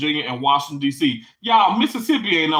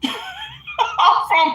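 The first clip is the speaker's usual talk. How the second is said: breaks into a high laugh mid-sentence. Real, not acted. Laughing at herself over being from Mississippi. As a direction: laugh right through the words.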